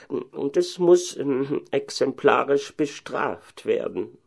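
slow, high-pitched pedantic cleric; learned head pastor. Acted. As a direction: pronounce it very slowly, voice pitched high. Head pastor continues demanding punishment. (gelehrter Oberpfarrer, gedehnt)

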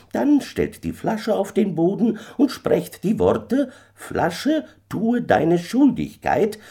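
high, swooping voice of the little stranger; kleiner Fremder. A narrator's reading. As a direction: instruct him like an uncanny little man, stretching key words. Stranger gives magic instructions. (kleiner Fremder, hoch und beschwörend)

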